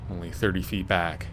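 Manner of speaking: usual speaking voice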